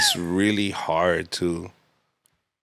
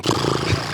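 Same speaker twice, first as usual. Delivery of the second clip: imitated pounding heart, voiceless flutter; wordless mock heart flutter; racing heart noise. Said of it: "my heart starts going".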